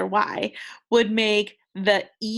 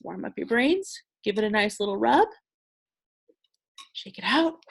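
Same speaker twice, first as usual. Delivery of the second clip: bouncy teacher cheer; upbeat warm-up call to the class; playful warm-up. Real, not acted.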